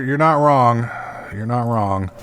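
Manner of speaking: ordinary delivery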